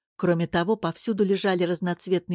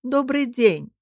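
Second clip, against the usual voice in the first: louder, higher call of hello; loud greeting; 6 semitones higher, 5 dB louder, slower than usual. A narrator's reading. Gota interrupts the arguing pair with a loud greeting. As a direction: announce yourself loudly. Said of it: "громко сказала Гота".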